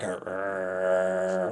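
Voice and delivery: long, low imitated growl; deep rumbling growl. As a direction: draw out a low growl from the throat. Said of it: "like a deep humbling growl".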